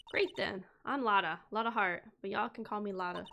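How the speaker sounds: put-on light Southern accent, bold and sassy. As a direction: play her as a feisty Southern gal. Voices Southern witness Lotta.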